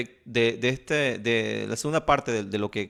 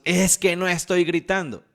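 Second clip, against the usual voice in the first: mock shouting denial; 6 semitones higher, 4 dB louder than usual. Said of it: "lo dices gritando".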